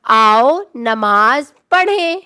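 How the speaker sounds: آؤ نماز پڑھیں spoken slowly and evenly; slow model sentence. Shown as a demonstration. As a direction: read it out slowly for the children. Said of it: "دوسرا جملہ دیکھتے ہیں". (آؤ نماز پڑھیں کو آہستہ آہستہ پڑھ کر دکھانا)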